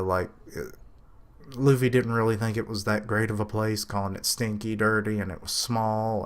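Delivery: normal talking voice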